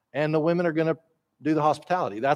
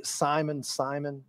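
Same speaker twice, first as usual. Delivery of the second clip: soft, gentle fond call, quieter; affectionate; 9 dB quieter, slower than usual. Acted. Preacher voicing Jesus fondly calling Simon, soft and tender. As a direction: say the name tenderly like a fond father. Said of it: "that affectionate way that he speaks".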